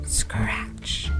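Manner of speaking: usual speaking voice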